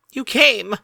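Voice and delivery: high, loud, delighted cry; excited cry. Acted. A mare overjoyed her friend came, crying out happily. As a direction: burst out in joy at seeing him. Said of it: "cried Derpy".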